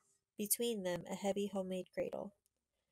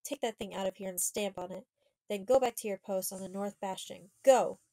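building to a loud shouted order; furious orders ending in a loud shout; angry command; 7 dB louder than usual. Acted. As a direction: drive the coward out. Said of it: "blazed the girl".

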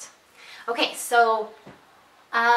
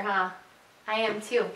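ordinary talk

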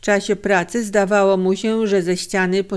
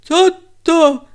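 dazed, startled, high-pitched questioning; high, confused stammer; bewildered mumbling; 8 semitones higher, 6 dB louder, slower than usual. A narrator's reading. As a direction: ask it haltingly, just come to and astonished. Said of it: "mamle zdziwiony".

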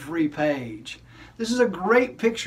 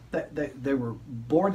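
bored drone; 5 semitones lower, 5 dB quieter than usual. Acted. Puppet stresses boredom, slow, low.

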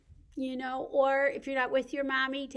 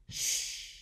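long shush. Pretend. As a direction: hush the little ones gently. Ends the Quiet Time rhyme.

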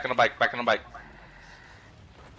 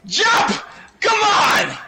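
excited shouting; much slower than usual. Real, not acted. Urging in race.

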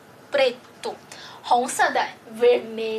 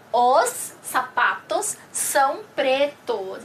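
slow, clear sentence for learners; slow model reading; slower than usual. Shown as a demonstration. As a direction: say "os sapatos são pretos" slowly, word by word. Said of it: "下面鞋是黑色的". (慢速示范句子)

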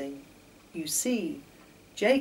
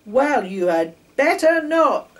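louder stern driver; storyteller's stern driver, louder; stern warning; 7 semitones higher, 10 dB louder than usual. A narrator's reading. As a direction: warn him sharply. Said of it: "said Donald Drew".

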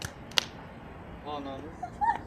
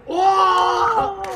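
excited shout; 7 semitones higher, 10 dB louder than usual. Real, not acted. Reacting to the dunk.

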